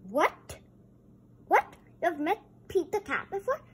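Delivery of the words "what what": ordinary delivery, nothing marked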